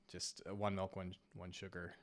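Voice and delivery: normal talking voice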